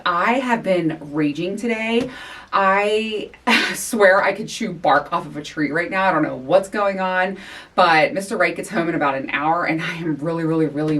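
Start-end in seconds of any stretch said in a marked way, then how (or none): none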